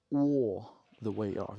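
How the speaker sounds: lightly voiced Rosie Perez accent, drawn out; slow, a hint of Rosie Perez accent. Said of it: "the Lisa Perez accent".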